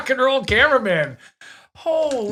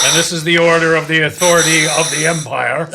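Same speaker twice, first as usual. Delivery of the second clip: a louder, hammed-up Darth Vader rumble; mock Vader proclaiming the Empire's authority; 4 semitones lower, 7 dB louder, faster than usual. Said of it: "how they make Darth Vader's sound".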